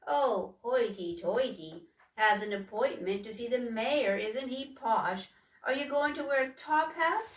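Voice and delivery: mock him in front of the gang; jeering. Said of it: "pointed at Humboldt and jeered".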